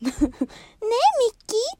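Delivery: very high cutesy call; Minnie calling Mickey. Retold or imitated. Daughter calling Mickey in her Minnie voice. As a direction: sing out sweetly for Mickey. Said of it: "必ずミニーちゃんやる".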